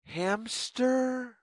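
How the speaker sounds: ordinary delivery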